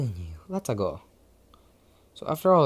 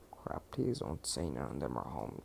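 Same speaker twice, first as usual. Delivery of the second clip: low, soft muttered worry; a real hushed aside, softer and lower; 9 semitones lower, 14 dB quieter than usual. Real, not acted. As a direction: say it under your breath, worried someone hears. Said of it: "worried someone home, hushed".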